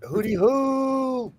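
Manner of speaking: high, slow owl hoot; playful slow owl call to the night owls. Pretend.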